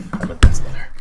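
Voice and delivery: unvoiced whisper into the mic. Real, not acted. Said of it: "I can hear you".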